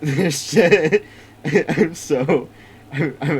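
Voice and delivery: giddy, loud laughter through words; can't contain the excitement, laughing; laughing excitedly. Real, not acted. Hyped start of game stream.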